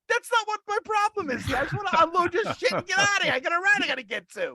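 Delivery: high, frantic retold plea; exasperated. Driver pleading with passenger to unload, high and frantic. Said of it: "I'm like".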